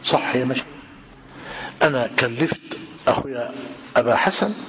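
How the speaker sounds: ordinary talk, nothing marked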